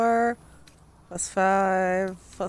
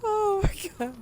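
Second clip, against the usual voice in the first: high, breathless giggling; laughing too hard to talk; 10 semitones higher than usual. Real, not acted. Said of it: "I can't stop laughing".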